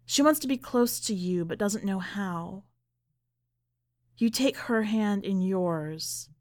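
ordinary delivery, nothing marked

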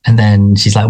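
ordinary talk, nothing marked

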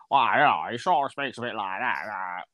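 lightly mimicked footballer, words slurred into noises; a rough impression trailing into mumbled sounds; Dennis Law impression. Showing a friend the old good impression, lightly mimicked. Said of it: "Dennis Law kind of".

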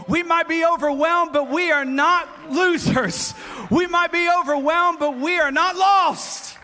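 loud, high, each line hammered; rousing refrain. Acted. Preacher rallying the congregation, loud and rousing. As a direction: drive the refrain home.

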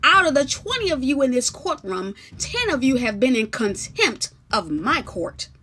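loud, high authoritative judge; commanding judge. Acted. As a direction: rule over the court firmly. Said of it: "Then Judge Jesus says".

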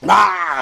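high, loud drawn-out "aah"; mock scream. Showing his co-host how unhappy he was, loud mock scream. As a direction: imitate screaming under attack. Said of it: "I was, like, screaming".

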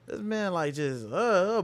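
high, startled gasp of disbelief; a shocked, breathless what; stunned disbelief. Retold or imitated. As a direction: react like you can't believe your eyes. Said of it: "I was like".

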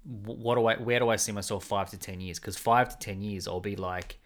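usual speaking voice

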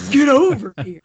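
loud, gritty ninja war cry; Scorpion voice. Pretend. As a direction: yell it like a fighting game ninja. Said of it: "She sounds like frickin' Scorpion".

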